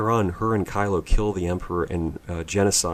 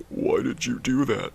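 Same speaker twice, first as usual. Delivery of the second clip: mock Kylo; 6 semitones higher than usual. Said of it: "And he's like".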